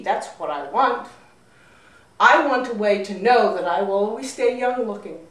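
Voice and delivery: a loud, petulant rich woman; indignant wealthy woman. Acted. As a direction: push hard, she won't take no.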